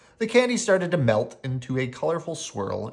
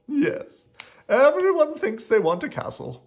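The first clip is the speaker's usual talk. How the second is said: sighed; 12 semitones higher, 6 dB louder than usual. Acted. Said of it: "Rocky sighed".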